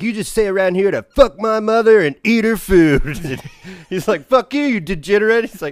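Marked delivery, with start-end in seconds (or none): 0.0-3.4 higher and louder hammed-up yell; mock yell. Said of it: "just yelling at each other". 4.3-5.5 higher, louder insult; mock yell. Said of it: "yelling at each other".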